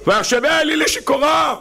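high shouted לשיכורה; proclaimed verse. Acted. (קריאה בקול רם וגבוה)

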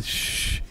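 hushed "shhh" of air; long shush-like exhale. Real, not acted. Preacher reacting to his own tale, soft shushing exhale. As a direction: let out a soft voiceless hiss. Wordless sound of awe.

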